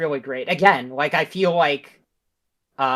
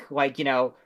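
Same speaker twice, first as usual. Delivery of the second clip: ordinary delivery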